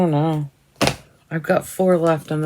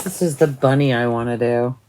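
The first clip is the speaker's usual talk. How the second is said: ordinary talk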